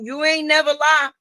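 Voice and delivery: normal talking voice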